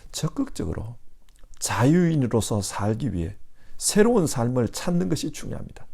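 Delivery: ordinary talk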